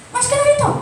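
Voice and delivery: high sharp command to leave; mock angry order. Shown as a demonstration. Spouse tells partner to get out, mock angry. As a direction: throw them out, mock angry. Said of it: "Hindi ka nagpapagalit na".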